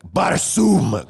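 high, punchy fantasy cuss word; mock swearing. Co-host pitching a made-up F word for the book, mock swearing.

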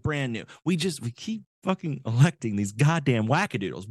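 normal talking voice

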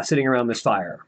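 normal talking voice

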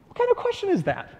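high-pitched, baffled disbelief; incredulous. Acted. Preacher voicing the prophet's reaction to God's question, incredulous. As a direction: squeak it out as if the question is absurd.

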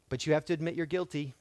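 ordinary talk

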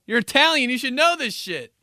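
high, loud scolding dad; dad scolding his son for not knowing pasta; outraged dad. Retold or imitated. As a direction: yell it like an Italian dad. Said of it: "He's like".

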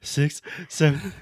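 mock panic, high and loud; panicky. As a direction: gasp them out in a fake panic. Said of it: "about to have a panic attack".

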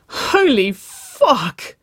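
drawn-out, high and loud exclamation; sobbed exclamation. A narrator's reading. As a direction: cry it out slowly, overwhelmed. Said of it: "Waverley sobbed".

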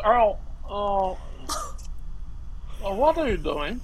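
put-on a bewildered elderly teacher interrupting; Bella impression. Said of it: "you do a great impression".

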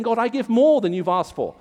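high, loud self-congratulating prayer; hammed-up spiritual show-off, pitch climbing to the end; mock boastful. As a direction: imitate a man smugly boasting to God. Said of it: "He boasts about his spirituality".

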